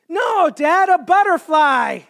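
lightly voiced kid, sure of herself; daughter correcting dad. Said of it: "She looks at me like I'm crazy".

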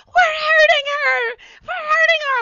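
loud, very high crying scream; mock distraught wail. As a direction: wail it as a hysterical mom. Acts mother wailing over cat.

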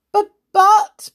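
high, loud stuttered objection; spluttered protest. Acted. Friend trying to object, spluttering.

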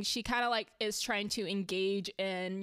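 usual speaking voice

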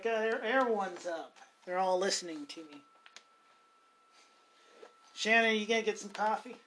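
ordinary talk

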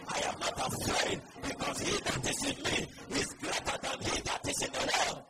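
loud, fervent proclamation; full-force preaching. Acted. Preacher defying the enemy, loud fervent proclamation. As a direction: preach it out over the congregation.

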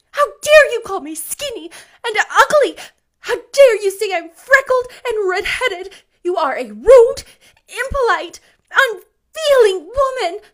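high, loud, tearful fury; passionate indignation. A narrator's reading. Anne hits back at being called ugly, indignant. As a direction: hurl it like an outraged child. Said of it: "passionate indignation exhaling from her".

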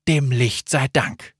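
usual speaking voice

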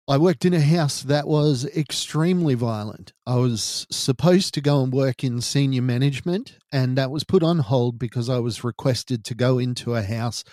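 ordinary delivery

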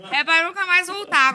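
said through laughter; laughing. Real, not acted. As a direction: laugh while repeating it.

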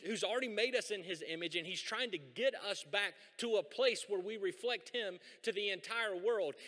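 normal talking voice

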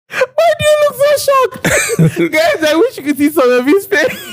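high-pitched, helpless laughing speech. Real, not acted. Laughing at co-host's shocked face, helpless laughter. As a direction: crack up while talking.

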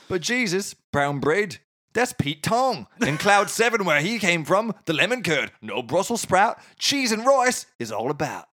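high-pitched Cockney drifting into Australian; Cockney gone Australian. Acted. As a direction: attempt broad Cockney, land in Australian. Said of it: "I went Australian again".

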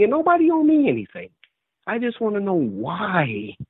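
holding back tears as it cracks upward; getting emotional. Real, not acted. Retired Marine pleading with the host, voice breaking high. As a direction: voice breaking high, rushing, then slowing right down. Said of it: "I'm getting emotional".

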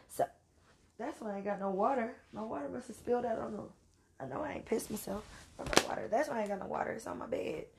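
soft, half-whispered confession to the audience; hushed aside. Real, not acted. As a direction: mutter it like an embarrassed side note. Embarrassed aside about spilled water.

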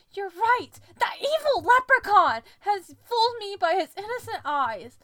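high, loud dramatic outrage; indignant yell. A narrator's reading. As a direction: yell it like a vow of revenge. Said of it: "I yelled".

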